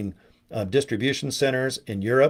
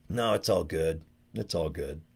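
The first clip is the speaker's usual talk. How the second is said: shrugging it off; 9 semitones lower, 9 dB quieter than usual. Retold or imitated. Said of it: "sorry you lost that game".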